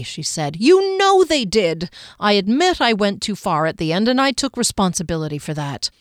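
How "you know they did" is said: high, slow and defiant; indignant Kier. Acted. Kier to Derry during their argument, high and slow. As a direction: Kier insisting she was right, drawn out.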